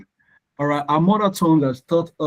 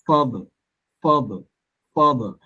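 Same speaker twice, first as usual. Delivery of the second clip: father repeated, strong FA, weak falling "ther"; stress on first syllable. Shown as a demonstration. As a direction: drill father, each time leaning on FA. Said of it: "it's louder".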